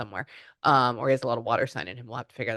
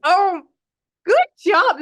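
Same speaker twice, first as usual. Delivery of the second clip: high, loud, emotional congratulations; overjoyed; 9 dB louder, slower than usual. Real, not acted. Reader congratulating a law school graduate, overjoyed. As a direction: gush with pitch way up.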